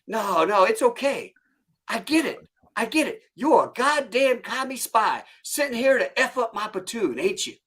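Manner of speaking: high-pitched drill instructor needling; mock sarcastic sergeant. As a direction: toy with the recruit, pretending to understand. Acted sergeant sarcastically accuses recruit.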